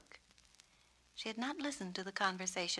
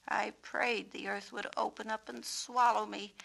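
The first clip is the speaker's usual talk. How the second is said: ordinary delivery, nothing marked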